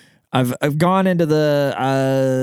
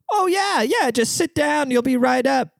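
eager high barber voice. Pretend. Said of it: "I get help".

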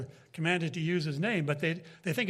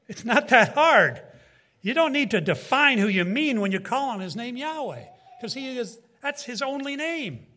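rising preacher's insistence; 6 semitones higher, 4 dB louder than usual. Real, not acted. Preacher pressing his point.

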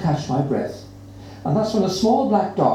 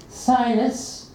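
higher, scornful name; the horrible girl mocks the cat's name; horrible girl sneering; 7 semitones higher than usual. A narrator's reading. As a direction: taste the name with contempt. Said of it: "says the horrible girl".

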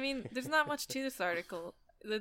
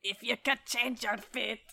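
mock Scottish accent. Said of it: "that's a good one to do".

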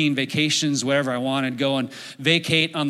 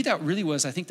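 usual speaking voice

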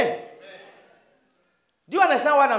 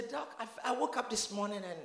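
much softer, fast and timid; quiet mock complaint; 2 semitones lower, 11 dB quieter than usual. Patient tells the doctor about chest discomfort, quietly and timidly. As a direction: play the meek patient.

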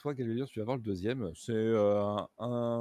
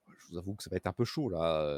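doubtful boatman; 7 semitones lower than usual. Acted. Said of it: "il fait".

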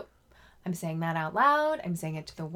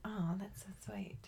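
soft touched whisper; breathy whisper, quietly moved; pleasantly surprised; 12 dB quieter than usual. Real, not acted. Touched by a friend's compliment.

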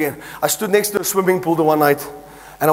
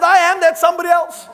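indignant claim; 9 semitones higher than usual. Retold or imitated. Arguing with his wife.

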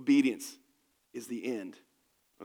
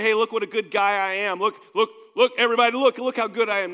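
higher, louder hammed-up bragging; mock boasting; 5 semitones higher, 9 dB louder than usual. Preacher mocking a boastful Peter to the church, hammed-up brag. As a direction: puff out the chest and crow.

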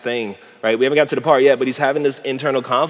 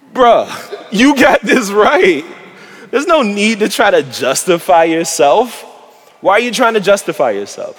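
incredulous laughing scold; 8 semitones higher, 6 dB louder than usual. Real, not acted. Preacher to the lawyer, loud laughing disbelief. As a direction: laugh at him, bruh, you already had it.

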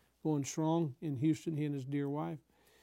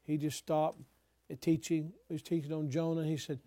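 ordinary delivery

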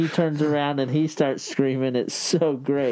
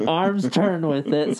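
ordinary delivery

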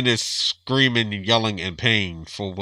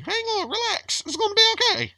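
falsetto, soothing a hasty husband; squeaky reassuring wife impression; high mock wife voice. Mocking wife, calming.

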